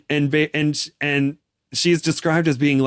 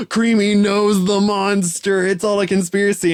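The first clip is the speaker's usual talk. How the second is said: hammed-up shock at a huge discovery; mock astonishment; 8 semitones higher, 4 dB louder than usual. Listener reacting to the book twist, high mock-scandalized outburst.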